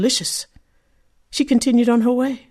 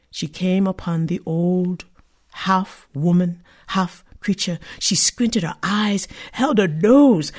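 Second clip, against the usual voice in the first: ordinary delivery, nothing marked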